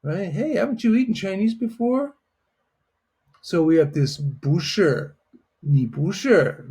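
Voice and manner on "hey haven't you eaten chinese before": higher, incredulous question rising on eaten; surprised disbelief. Shown as a demonstration. Teacher modelling a puzzled friend's question, higher. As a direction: ask as if it's strange they never did. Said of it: "You get a little attitude like".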